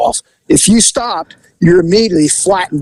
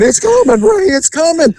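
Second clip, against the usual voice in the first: panicked warning; 11 semitones higher than usual. Retold or imitated. Sick passenger warns the driver.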